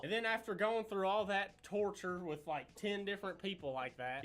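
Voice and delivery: usual speaking voice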